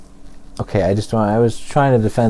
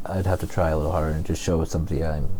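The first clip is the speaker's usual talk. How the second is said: ordinary talk, nothing marked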